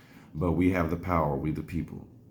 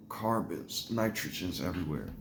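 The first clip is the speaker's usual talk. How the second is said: soft retold waking-up voice; groggy waking mumble; 8 dB quieter than usual. Patient waking from a bronchoscopy, imitated groggy mumble. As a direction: say it quietly, as if just coming round. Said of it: "when I woke up".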